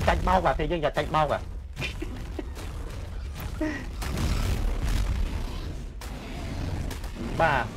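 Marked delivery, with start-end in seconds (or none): 1.4-7.3 long airy laughing fit; can't stop laughing; helpless giggling. Real, not acted.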